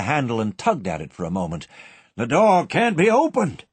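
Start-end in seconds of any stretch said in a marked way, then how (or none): 2.2-3.6 put-on higher, louder, a despairing old man; groaning old banker. A narrator's reading. Old banker groaning at the locked vault, despairing. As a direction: groan it out in alarm. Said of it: "he groaned".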